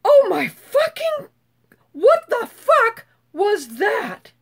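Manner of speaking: drawn-out, high-pitched amazement; slow, emphatic awe, starting high; astonished reaction. Retold or imitated. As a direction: stretch each word in stunned disbelief. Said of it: "and I went".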